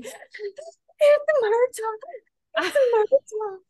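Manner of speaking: high, slow mock-sobbing "It's Murtaugh"; imitating sobbing. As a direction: replay the hysterical crying, drawn out and high. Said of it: "I'm, like, hysterically sobbing".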